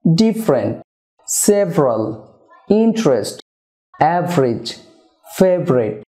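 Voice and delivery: "ডিফারেন্ট" as dif-ren, "সেভারাল" sev-ral, "ইন্টারেস্ট" in-trest, "অ্যাভারেজ" av-rij, "ফেভারিট" fev-rit; native-style two syllables. Shown as a demonstration. Teacher reviews the words, "ডিফারেন্ট" to "ফেভারিট" each without vowel before r. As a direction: drop the vowel before r in each word from "ডিফারেন্ট" to "ফেভারিট", falling, pauses between. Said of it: "ওয়ার্ডগুলোকে আরও একবার দেখি".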